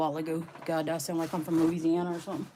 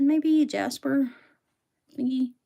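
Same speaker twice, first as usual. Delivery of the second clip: playful guess; 6 semitones higher, slower than usual. Real, not acted. Guessing rock type.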